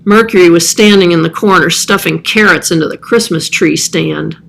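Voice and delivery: usual speaking voice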